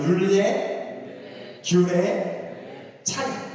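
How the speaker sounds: teacher leading a chant; call-and-repeat drill. Shown as a demonstration. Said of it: "다시 한번 따라해볼게요".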